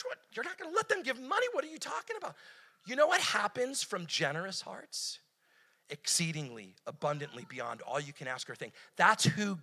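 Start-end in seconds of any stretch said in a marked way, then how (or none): 0.0-2.4 high, fast mock disbelief; incredulous outburst. Churches refusing generous givers. 5.9-8.7 Exceedingly, abundantly spoken softly, falling; hushed scripture. Shown as a demonstration.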